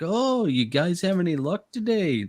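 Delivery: put-on high, sing-song Canadian accent; chirpy Canadian angler asking about luck. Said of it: "super Canadian accent".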